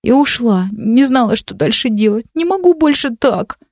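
put-on despairing girl, voice breaking upward; upset girl. A narrator's reading. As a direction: near tears, pleading.

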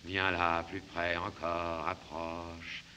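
low, soft and slow; coaxing murmur. Acted. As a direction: lure her in quietly. Luring her closer.

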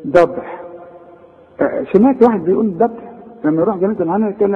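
usual speaking voice

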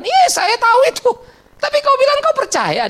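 high, loud rebuke; preacher's shout. Acted. Preacher rebukes the fearful believer's excuse with a loud high shout. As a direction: shout the objection down.

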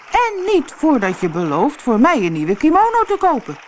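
high, insistent demand; calling out. A narrator's reading. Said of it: "riep de man die zijn kimono kwijt was". (eisend geroep)

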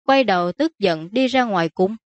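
ordinary delivery

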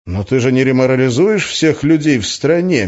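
ordinary delivery

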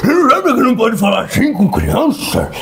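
hammed-up relative playing table police; scolding relative. Relative Jorge scolds the narrator at Christmas dinner, mock outrage. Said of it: "Aí o parente distante, Jorge".

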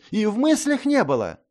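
high frantic protest; panicked denial. A narrator's reading. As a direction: blurt it out scared. Youth denies in panic.

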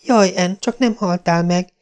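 put-on high frightened girl's cry; Diana crying out. A narrator's reading. Diana kneeling by Anne, high and frightened. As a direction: call out in panic to her fallen friend. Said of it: "kiáltotta".

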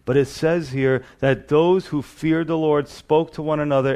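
ordinary talk, nothing marked

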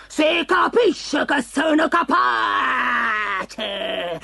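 very slow, stretched monster roar; roaring lion. A narrator's reading. Lion stung by the cat's dare, long offended roar. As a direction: roar it out, stung, holding the last word long. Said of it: "ruggì il leone, punto sul vivo".

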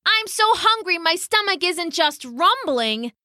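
high, whining hungry bird; whiny hungry pheasant. Acted. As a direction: moan about hunger up high. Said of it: "said the pheasant".

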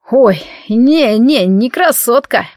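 put-on slurred, slow drunken hiccup. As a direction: drunk lout hiccuping over words. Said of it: "пьяно икнуло это недоразумение". (пьяный голос с иканием)

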